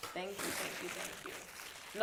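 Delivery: soft thanks fading to a whisper; whispered prayerful thanks. Real, not acted. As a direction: trail off into whispered gratitude. Host overwhelmed, thanking God.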